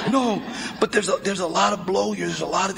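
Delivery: usual speaking voice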